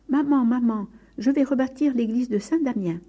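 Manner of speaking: joyful excitement. A narrator's reading. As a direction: burst in with happy news. Bursting in joyfully to mother.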